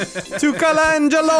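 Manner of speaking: high, hammed-up Hawaiian island accent. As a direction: welcome them like a Hawaiian islander. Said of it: "uh, Hawaiian accent".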